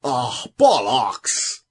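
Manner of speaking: slow, drawn-out exclamation in a mock Irish accent. Co-host exclaiming in a mock Irish accent, then laughing. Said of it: "Avec mon bel accent Irish".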